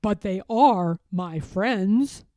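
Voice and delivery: slow, fond peddler; gently to the children; tender peddler. Acted. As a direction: say it with affection. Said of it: "he added quickly".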